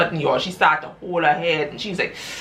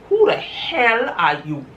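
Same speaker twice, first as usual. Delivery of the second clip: higher, slow, indignant; indignant demand; 6 semitones higher, slower than usual. Retold or imitated. Wife to the woman in her bed, slow indignant demand. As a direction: spit it at the stranger.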